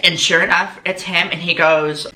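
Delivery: normal talking voice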